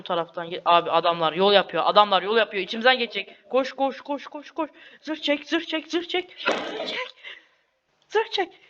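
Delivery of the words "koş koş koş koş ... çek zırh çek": high, rapid frantic commands; panicked urging. Real, not acted. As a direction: yell run and armor up in panic. Enemies crossing, urging himself. (panik içinde bağırmak)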